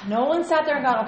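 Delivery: usual speaking voice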